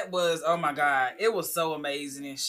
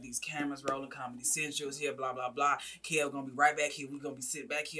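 low-key mentor; 3 semitones lower, 8 dB quieter than usual. Retold or imitated. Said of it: "He was like".

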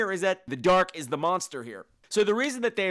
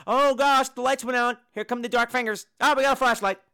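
louder, higher mock panic; mock dramatic; 7 semitones higher, 6 dB louder than usual. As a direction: ham up the repetitive scares. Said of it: "same thing over and over".

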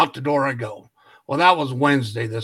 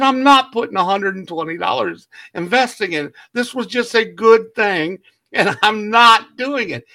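higher and louder, building with a laugh; indignant refusal; 5 semitones higher, 6 dB louder than usual. Retold or imitated. Telling the neighbor he won't pay again, retold indignation. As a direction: dig in and refuse, worked up.

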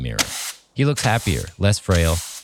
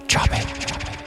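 eerie unvoiced whisper; 3 dB quieter than usual. A narrator's reading. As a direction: breathe it out like ghostly hair. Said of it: "He hears a barely audible whisper".

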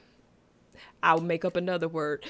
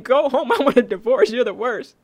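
laughing mock rejection; 4 semitones higher, 7 dB louder than usual. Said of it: "And she's like".

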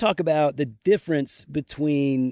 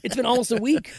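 high, insistent objection; indignant protest; 11 semitones higher than usual. Real, not acted. Husband disputing how long his wife's been gone, indignant. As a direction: protest in a raised pitch. Indignant argument over days away.

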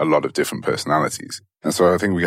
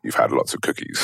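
stern whispered scolding; 5 semitones lower than usual. Acted. Brain scolding the body.

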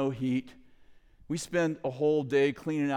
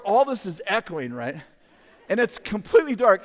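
normal talking voice

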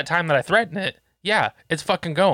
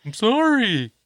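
high-pitched mock child's apology; imitated kid; 8 semitones higher, 3 dB louder, much slower than usual. Scolded kid apologising to dad, in a mimicked high voice. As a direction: put on a kid's high voice.

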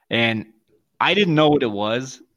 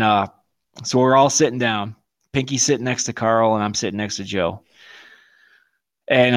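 normal talking voice

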